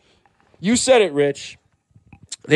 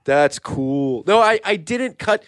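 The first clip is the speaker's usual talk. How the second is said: normal talking voice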